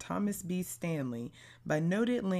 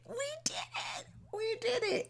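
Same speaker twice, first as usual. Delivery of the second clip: squeaky, much higher triumphant cheer; giddy celebration; 3 dB quieter than usual. Real, not acted. Host celebrates finishing the episode with listeners, squealing.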